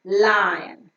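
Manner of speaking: "Lion" modelled slowly as LY-un; correct lion pronunciation. Shown as a demonstration. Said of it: "it's to be pronounced as lion".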